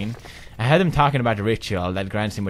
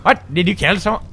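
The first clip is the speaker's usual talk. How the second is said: loud high call downward; shouting down; 10 semitones higher, 12 dB louder than usual. Real, not acted. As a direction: yell across the room. Calling down to a character.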